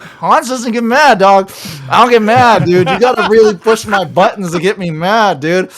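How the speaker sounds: getting riled just from retelling it; heated protest. Real, not acted. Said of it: "I've never been that mad before".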